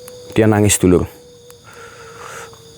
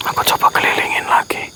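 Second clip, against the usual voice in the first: hushed whisper while searching; 9 dB quieter than usual. Real, not acted. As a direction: whisper warily to your viewers. (berbisik sambil mencari)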